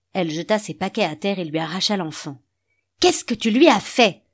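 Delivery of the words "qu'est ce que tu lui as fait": higher, louder accusing shout; angry cry. A narrator's reading. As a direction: hurl the accusation at him. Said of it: "cria-t-elle".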